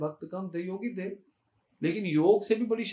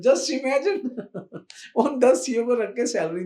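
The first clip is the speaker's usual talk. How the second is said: laughing, higher and louder; amused disbelief; 5 semitones higher, 5 dB louder, slower than usual. Real, not acted. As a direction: break into a laugh of amazement. Amazed at his own example.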